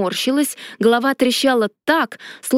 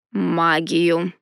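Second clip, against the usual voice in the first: low husband voice; 5 semitones lower than usual. A narrator's reading. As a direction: finish her thought in a low calm voice. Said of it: "закончил за меня мой супруг".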